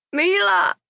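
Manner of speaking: high, loud cry of her name; high, loud, falling cry; anguished cry. Acted. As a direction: call after her in despair. Husband cries her name.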